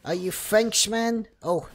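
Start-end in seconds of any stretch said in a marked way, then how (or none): none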